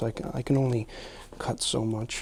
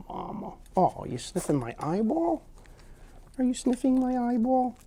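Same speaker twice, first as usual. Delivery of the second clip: high pet talk; 11 semitones higher than usual. Real, not acted.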